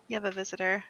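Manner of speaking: usual speaking voice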